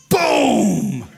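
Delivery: loud shouted imitation of a blast; explosion boom. Pastor acting out his grill exploding, loud boom. Match thrown into soaked grill.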